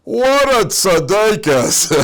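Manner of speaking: loud, high, drawn-out cry of admiration; imitated lady's gushing outburst; unrestrained exclamation. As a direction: burst out as if unable to hold back. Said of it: "couldn't restrain herself".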